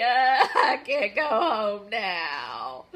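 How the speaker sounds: slow, loud, sobbing plea; the fairy crying out that she's stranded; tearful cry. Acted. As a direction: wail it through tears. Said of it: "cried the Tooth Fairy".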